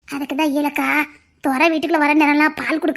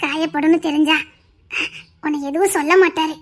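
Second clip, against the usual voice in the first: ordinary delivery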